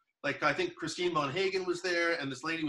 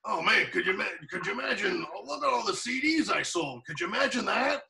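higher, excited gushing about sales; gushing comic; 6 semitones higher than usual. Retold or imitated. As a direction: brag with delighted amazement. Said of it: "and he's like".

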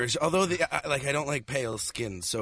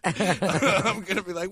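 usual speaking voice

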